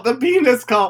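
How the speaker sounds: shrieks the phrase high and loud; excited shriek. Real, not acted. Podcast cohost shrieking about a reality show cult, excited.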